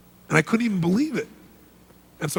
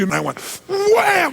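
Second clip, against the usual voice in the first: high, loud, drawn-out "wham!"; 5 dB louder, slower than usual. Retold or imitated. Retelling hitting the chairman twice, a loud slow wham. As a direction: stretch the wham out like a big blow. Acting out second hit.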